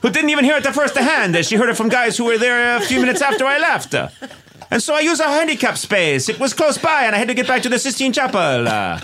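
high, laughing, hammy fake Italian; bad Michelangelo impression. Michelangelo defends himself at Chick-fil-A in a hammy Italian accent. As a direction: play Michelangelo with a silly high Italian voice, chuckling. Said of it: "the worst Michelangelo impression".

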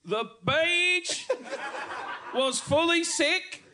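high, slow hammed-up Australian accent; mock Australian accent. John in the Australian dialogue, hammed-up high Australian accent. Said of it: "I'll be John".

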